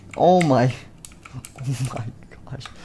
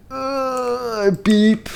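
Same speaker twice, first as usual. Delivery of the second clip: straining cry; 12 semitones higher than usual. Acted. Phantom Bonnie straining to press the button, crying out.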